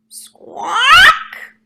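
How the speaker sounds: a high, loud parrot squawk; loud bird squawk; shrill squawk. Acted. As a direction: screech like a parrot. Parrot's cry.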